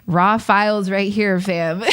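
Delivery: usual speaking voice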